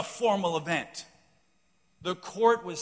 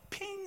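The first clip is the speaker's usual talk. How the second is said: high, soft ping of the club; a light, high mouth-sound ping; ping of the ball; 9 dB quieter than usual. Retold or imitated. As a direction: imitate the club striking the ball. Imitating the ball being struck.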